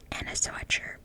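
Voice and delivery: plain reading voice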